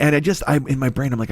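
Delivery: ordinary talk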